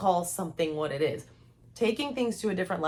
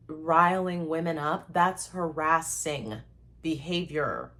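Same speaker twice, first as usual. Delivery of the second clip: normal talking voice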